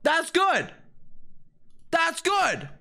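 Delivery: high, insistent cries of approval; excited exclamation. Real, not acted. Gamer reacting to a good piece, high excited exclamation.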